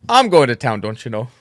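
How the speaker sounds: a light Minnesotan repeat; Minnesotan accent. Shown as a demonstration. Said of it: "go ahead and [name], try".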